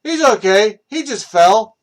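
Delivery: sarcastic impression of the careless driver; mocking the driver. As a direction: wave it off as nothing. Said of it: "He takes it as like".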